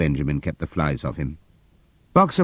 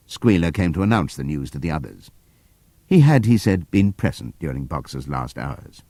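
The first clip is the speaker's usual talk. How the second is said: ordinary delivery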